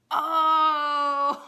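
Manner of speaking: loud high groan-cry at her own joke; a real mock-horrified yelp; cringing exclamation. Real, not acted. She cries out at her own terrible punchline, mock-horrified.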